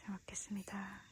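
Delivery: soft unvoiced whisper. Real, not acted. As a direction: hush it so nobody wakes. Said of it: "목소리 크게 안 내서 돼서 좋다".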